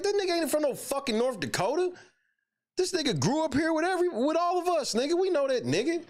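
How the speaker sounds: voicing an indignant old classmate; calling out a liar.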